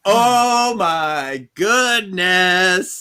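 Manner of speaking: slow, loud, high exclaim; mock gasp. Real, not acted. Host reacting to the cliffhanger, slow and loud. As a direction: draw it out in shock.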